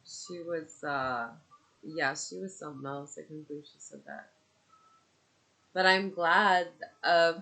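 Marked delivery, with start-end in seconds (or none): none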